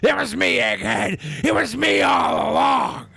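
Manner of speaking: high gloating villain shout; evil Mister McMahon reveal. Retold or imitated. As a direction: gloat like a wrestling heel. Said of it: "the evil Mister McMahon".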